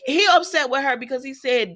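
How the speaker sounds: ordinary talk, nothing marked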